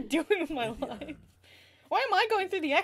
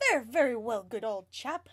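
put-on overly British accent; 8 semitones lower, 5 dB quieter than usual. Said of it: "I love the overly British accents".

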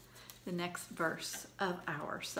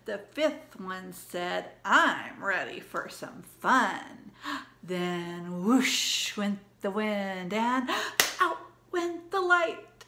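storytime recitation; 5 dB louder, slower than usual. Acted. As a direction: lively rhyme read to kids. Second recital of rhyme.

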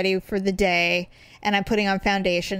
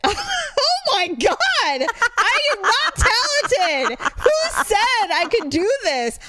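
high, drawn-out mock horror; hammed-up appalled cry; horrified outburst; 13 semitones higher, 3 dB louder, slower than usual. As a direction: wail it slowly in comic disbelief. Retelling horror at own recording.

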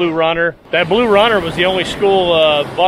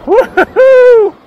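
excited whoop; 9 dB louder than usual. Real, not acted. Catching first bonita, shout.

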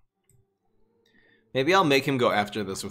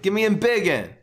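louder demand for the card; demanding shout; 7 semitones higher, 6 dB louder than usual. Real, not acted. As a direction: call out for the card. Pleading for a card draw.

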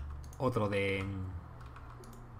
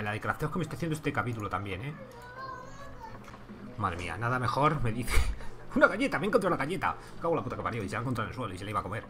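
ordinary talk, nothing marked

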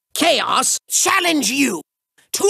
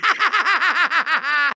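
evil laugh; 3 semitones lower than usual. Acted. Villain gloats.

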